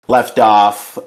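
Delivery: usual speaking voice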